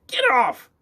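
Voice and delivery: very high, very loud witch scream. A narrator's reading. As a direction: scream the order. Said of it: "went on screaming".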